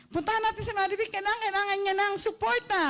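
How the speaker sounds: high, eager voice of worried siblings; rally the family, pitched high; concerned siblings. Retold or imitated. Siblings urging each other to visit her, high and eager. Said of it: "gusto kong suportahan".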